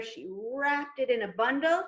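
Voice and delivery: plain reading voice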